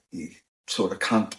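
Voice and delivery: ordinary talk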